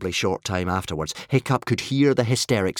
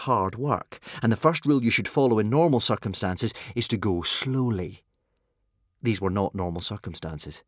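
ordinary delivery

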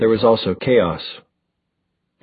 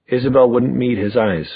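usual speaking voice

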